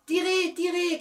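high, loud cheering shout. Acted. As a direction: yell them on to pull harder. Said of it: "cria-t-il".

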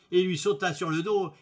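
ordinary delivery, nothing marked

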